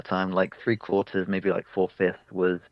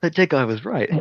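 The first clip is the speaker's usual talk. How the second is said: bard's line, voiced; 7 semitones higher than usual. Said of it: "the bard's like".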